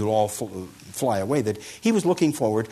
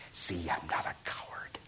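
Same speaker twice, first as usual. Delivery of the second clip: whispered pride; 6 semitones lower, 9 dB quieter than usual. Acted. Wounded monk smiling after beatings.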